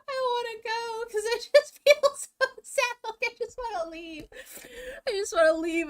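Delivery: very high, loud fake crying; mock wailing. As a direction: ham up a tearful whine.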